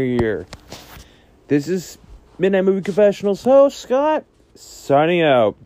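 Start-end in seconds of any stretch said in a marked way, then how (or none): 1.5-5.6 announcer sign-off. Acted. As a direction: sign off the show like a host, stretching the end. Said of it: "sign-off announcer".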